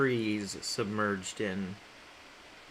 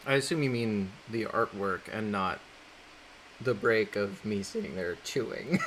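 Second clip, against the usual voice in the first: usual speaking voice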